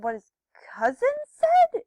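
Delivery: acted shock, voice shooting up; incredulous question. Asking Hannah about the cousin's story, incredulous. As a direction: leap high and loud in disbelief.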